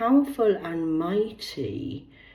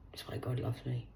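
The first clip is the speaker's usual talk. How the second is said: whisper; 3 semitones lower, 9 dB quieter than usual. Real, not acted. Taunting whisper in a rant.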